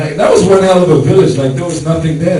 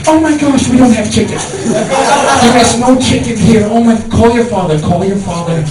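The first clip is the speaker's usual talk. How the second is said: high, frantic mother voice; panicked mom; 6 semitones higher than usual. Retold or imitated. Mother panicking that Isaac is coming, high frantic voice. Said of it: "It's like".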